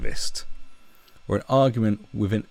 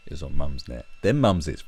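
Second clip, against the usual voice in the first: normal talking voice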